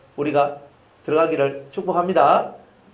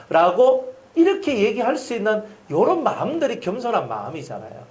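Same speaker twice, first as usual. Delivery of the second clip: usual speaking voice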